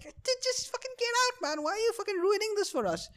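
very high, soft mock whine; complaining classmate. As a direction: imitate a classmate pleading in a squeak. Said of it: "always some guy who's like".

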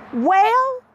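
high, loud drawn-out well, imitating her mother; mock her mother's casual well; playful impression of a carefree mom; mom's shrugging well.

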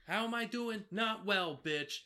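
loud, high catchphrase impression; Dorinda. Co-host attempting the housewife's catchphrase, loudly imitated. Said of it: "Can you try?".

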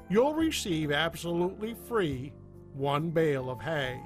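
upbeat radio commercial voice; ad read. Acted. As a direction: sell it like a cheesy radio ad. Sponsor ad read over music.

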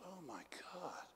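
stunned breathy whisper; shocked whisper. Retold or imitated. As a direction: breathe it out in dismay. Young pastor realizes his mistake.

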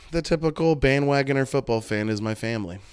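ordinary talk, nothing marked

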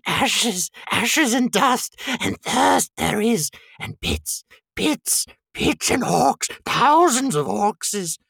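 high, hissing creature pleading; Gollum. A narrator's reading. Creature begging hobbits to avoid Mordor, high drawn-out hissing. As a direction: plead in a high strained voice, stretching words. Reads Gollum's lines in character.

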